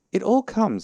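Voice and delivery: high, loud indignant complaint; cross. A narrator's reading. Pooh blaming Rabbit's front door, cross. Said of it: "said Pooh crossly".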